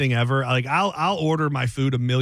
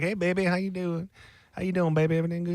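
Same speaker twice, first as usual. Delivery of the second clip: lightly voiced Southern waitress; a hint of a sweet Southern server; diner waitress; 4 semitones higher, 4 dB quieter, faster than usual. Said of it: "nice Southern lady there. She's like".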